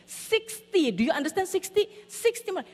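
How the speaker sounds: SIXTY hit high and insistent each time; high-pitched, pointed stress on SIXTY; exasperated emphasis. Retold or imitated. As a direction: drum SIXTY into a stubborn child. Parent drums number into child.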